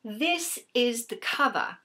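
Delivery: slow, put-on British accent; amateur British accent on a book cover; mock British accent. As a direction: try out a British accent, slowly. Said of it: "say the rest ... a British accent".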